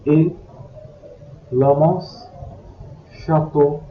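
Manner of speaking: usual speaking voice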